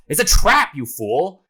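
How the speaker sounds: high, loud warning shout; hammed-up alarmed shout; acted yell. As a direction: yell a warning, punching the first words. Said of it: "And then Groose yells".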